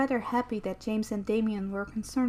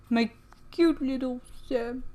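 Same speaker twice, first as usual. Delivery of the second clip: plain reading voice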